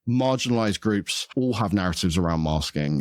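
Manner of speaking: usual speaking voice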